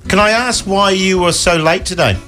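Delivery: usual speaking voice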